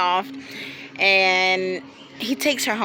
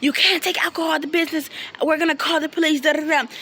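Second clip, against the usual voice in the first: high-pitched imitation of staff calling out; retold yell, raised pitch only; mock yell; 6 semitones higher, faster than usual. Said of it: "people from the restaurant yell out".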